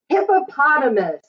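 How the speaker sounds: plain reading voice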